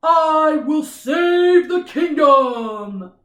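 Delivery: loud, slow lion roar-voice; big booming lion. Shown as a demonstration. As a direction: boom it out like a brave lion. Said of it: "I'm gonna use a lion voice".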